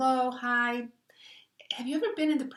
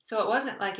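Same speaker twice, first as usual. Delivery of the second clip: usual speaking voice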